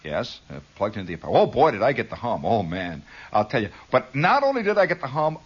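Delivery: usual speaking voice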